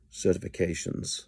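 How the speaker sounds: ordinary delivery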